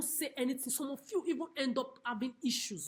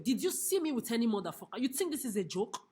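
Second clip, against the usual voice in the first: normal talking voice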